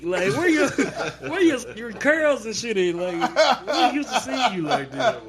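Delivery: high-pitched ribbing through laughter; incredulous, giggly retelling; laughing teasing. Retold or imitated. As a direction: roast a friend while cracking up. Retelling teasing over haircut, laughing.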